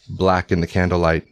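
ordinary delivery

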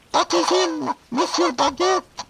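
very high squeaky femme fatale; falsetto lady voice. Acted.

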